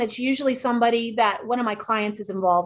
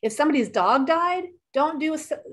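ordinary talk

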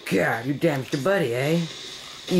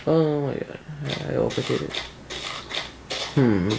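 ordinary talk, nothing marked

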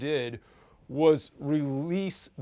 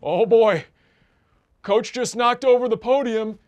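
urgent alarmed report, louder and higher; breathless warning that the coach is coming; excited producer in ear; 7 semitones higher, 8 dB louder than usual. Retold or imitated. Producer in his earpiece warning the coach is coming, alarmed. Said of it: "in my ear saying".

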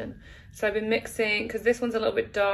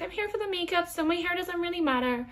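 mock airy quote; 8 semitones higher than usual. Mock quote of her own attitude.

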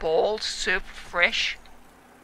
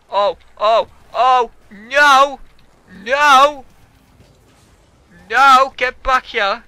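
panicked yelling; 3 semitones higher, 12 dB louder, slower than usual. Real, not acted. Game going wrong, yelling.

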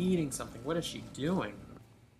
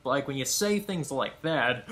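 ordinary delivery

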